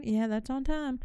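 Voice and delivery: usual speaking voice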